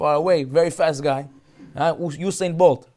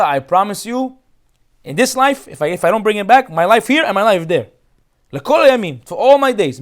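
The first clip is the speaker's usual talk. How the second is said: raised, pleading oath to his father; impassioned vow; 5 semitones higher, 5 dB louder than usual. Acted. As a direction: swear it with fervor, voice lifted. Yehuda's solemn vow to father.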